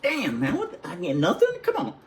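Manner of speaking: high-pitched, whiny protest as a retold kid; mock indignant. Boy protesting to his uncles about no prize, mock indignant. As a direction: imitate a let-down winner, voice jumping up.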